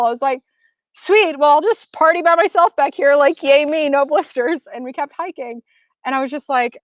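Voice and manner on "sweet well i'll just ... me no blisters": high-pitched, lightly self-mocking cheer; mock celebration. Partying by myself behind Barry, mock celebration. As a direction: cheer yourself on in a small high voice. Celebrating alone after partner's cool reply.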